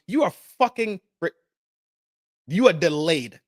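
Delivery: usual speaking voice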